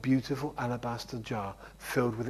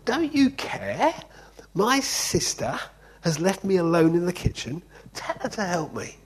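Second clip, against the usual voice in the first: flustered Martha; 6 semitones higher, 5 dB louder than usual. Acted. Martha bursting out of the kitchen at Jesus, high flustered complaint. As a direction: pitch it up as a frantic overworked sister. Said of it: "absolutely flustered and said".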